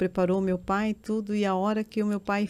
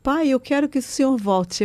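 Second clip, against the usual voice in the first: higher, louder, pleading through tears; desperate tearful plea; 5 semitones higher, 6 dB louder than usual. Retold or imitated. Daughter begging her father at the surgery door, loud tearful plea. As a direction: beg him to come back, voice near breaking. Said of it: "Eu me desesperei".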